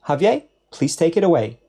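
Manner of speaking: ordinary talk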